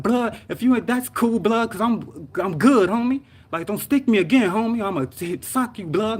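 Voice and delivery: high-pitched, heated protest; retold mad warning. Patient to paramedic sticking his arm, mad and threatening. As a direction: warn them off, worked up. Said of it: "I got mad at him".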